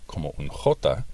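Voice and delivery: ordinary talk, nothing marked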